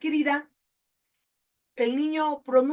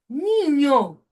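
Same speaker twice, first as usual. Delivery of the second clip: "Niño" loud and falling; stressed keyword; 3 semitones higher, 6 dB louder than usual. Shown as a demonstration. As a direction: hit "Niño" hard, then drop. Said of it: "Dice aquí".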